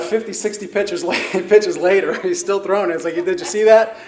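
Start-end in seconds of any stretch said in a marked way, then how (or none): 3.2-4.0 laughing, louder, higher; laughing through quote. Retold or imitated. Laughing as he quotes his old coach. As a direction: chuckle through the coach's line.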